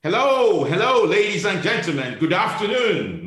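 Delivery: loud, high ceremonial greeting; pompous host's welcome, swooping in pitch; grand show opening. Acted. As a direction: proclaim it to a big audience, stretching the greetings. Host opens his show grandly.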